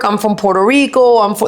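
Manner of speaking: usual speaking voice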